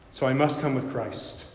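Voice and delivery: ordinary delivery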